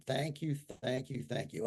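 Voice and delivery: quiet closing thank yous; soft thanks. Shown as a demonstration. Closing his affirmation with soft murmured gratitude. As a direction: let the thanks fall away softly. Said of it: "eh, you ready?".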